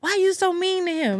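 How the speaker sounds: high, sing-song scolding question; mock scolding friend. Friends questioning her about her partner, high mock scold.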